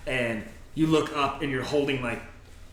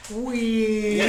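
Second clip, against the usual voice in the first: imitated wee; 9 semitones higher, 6 dB louder than usual. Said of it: "everyone was like".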